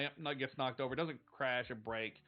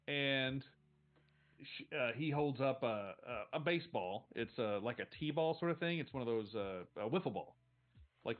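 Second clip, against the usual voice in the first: ordinary talk, nothing marked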